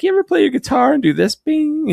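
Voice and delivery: high-pitched, quick comic bit; high, fast bit ending on a ringing bing; comedian impression. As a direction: imitate a stand-up quizzing musicians. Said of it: "he's like".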